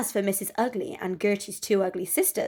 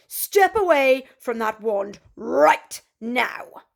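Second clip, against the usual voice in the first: slow, louder stern demand; angry command; 4 semitones higher, 6 dB louder, slower than usual. Acted. Cinderella orders the fairy, slow and angry. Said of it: "an angry voice".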